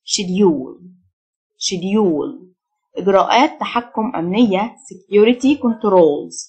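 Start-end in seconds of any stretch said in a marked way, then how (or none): 0.1-0.8 schedule the British way, "SHED-yool"; British pronunciation. Shown as a demonstration. Teacher contrasting accents for learners, British "sh" in schedule. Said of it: "النطق البريتش". 1.6-2.5 schedule the British way, "SHED-yool"; British pronunciation. Shown as a demonstration. Teacher contrasting accents for learners, British "sh" in schedule. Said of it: "النطق البريتش".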